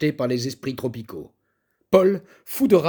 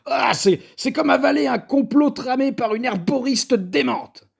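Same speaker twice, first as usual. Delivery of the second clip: high sputtering disgust; disgusted outrage; 10 semitones higher, 4 dB louder than usual. A narrator's reading. Paul after tasting her stew, high disgusted sputter. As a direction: choke on the flavour in outrage.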